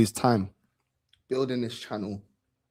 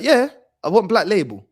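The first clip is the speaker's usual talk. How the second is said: insistent granddad; 7 semitones higher, 7 dB louder than usual. Retold or imitated. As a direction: push the word "Yeah" up and loud, no arguing. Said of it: "He's like".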